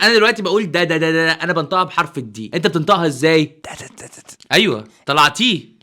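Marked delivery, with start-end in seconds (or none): 3.5-4.4 whispered دا coming out as تا; همس. Shown as a demonstration. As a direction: breathe دا دا دا without voice. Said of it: "وانت بتوشوش".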